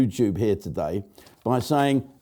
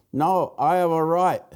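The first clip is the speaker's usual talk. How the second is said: mock Japanese accent; 5 semitones higher than usual. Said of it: "playing a Japanese bloke".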